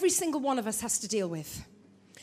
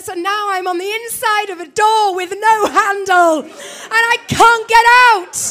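rising alarm; 3 semitones higher, 10 dB louder than usual. Retold or imitated. Locked in, panicking.

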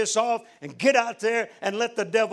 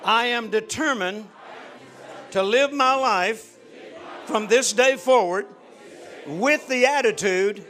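led prayer; 5 dB louder than usual. Acted. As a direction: lead them in prayer, line by line. Leads congregation in prayer.

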